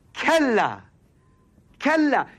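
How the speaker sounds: firm, forceful kalla. Retold or imitated. Khadija to the Prophet, firm forceful kalla. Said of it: "She says it with force".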